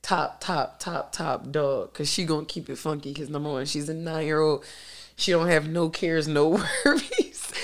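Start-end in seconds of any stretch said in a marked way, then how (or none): 6.3-7.5 1 laughing. Real, not acted. Laugh in word.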